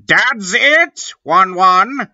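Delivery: high, loud exasperated snap; angry shout. A narrator's reading. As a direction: yell it, fed up. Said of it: "Cornelio Fuck shooted angrily".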